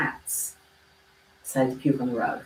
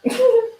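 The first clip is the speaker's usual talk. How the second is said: burst of laughter; 6 dB louder than usual. Real, not acted. Breaks character laughing.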